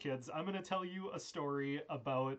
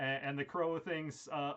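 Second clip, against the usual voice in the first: ordinary talk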